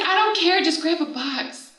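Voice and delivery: high-pitched, exasperated mock order; impatient. Sister on the phone ordering any box, impatient. Retold sister losing patience.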